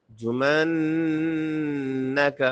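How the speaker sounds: جمنک slowly, humming long on the nn; ghunna on doubled noon. Shown as a demonstration. Teacher models ghunna for learners, drawn-out nasal n in جمنک. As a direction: hold the n of جمنک in the nose. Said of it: "نون مشدد کا غنہ ہے".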